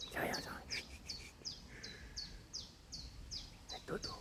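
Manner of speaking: soft shushing whisper; hushing whisper. Real, not acted. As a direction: whisper them to sleep. Hushing sleeping birds.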